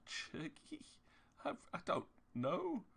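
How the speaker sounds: breathy high puzzled Churgi; repeating the strange word in confusion; baffled squeak. Retold or imitated. Reacting to the director's question, high baffled squeak.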